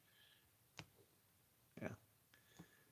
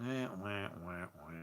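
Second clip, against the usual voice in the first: trailing, softer wah-wah sounds; imitated wah-wah noise; 3 semitones lower, 10 dB quieter than usual. Person who doesn't care doing the job, imitated wah-wah droning. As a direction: mimic droning noise, fading away.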